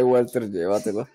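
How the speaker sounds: Walter first, then llévatelo; Yoda-style reversed order, Walter llévatelo; backwards word order. Shown as a demonstration. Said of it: "No puedes decirlo al revés".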